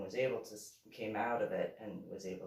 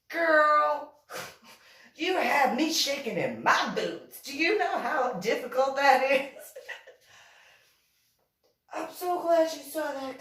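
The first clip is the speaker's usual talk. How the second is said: much higher and louder, gushing over her; gushing high girl voice; 13 semitones higher, 11 dB louder than usual. Retold or imitated. Woman fawning over the narrator, in a loud high gushing voice. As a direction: swoon loudly like a starstruck fan.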